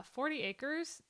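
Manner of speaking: ordinary delivery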